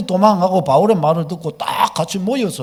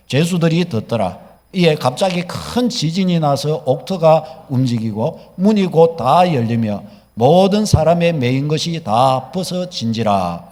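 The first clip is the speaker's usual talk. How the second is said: slow, lower-pitched solemn reading; scripture reading; 5 semitones lower, 4 dB louder, slower than usual. Real, not acted. Preacher reading Acts to the congregation, slow scripture reading. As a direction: read the verses out slowly and deliberately. Reads the Bible passage aloud.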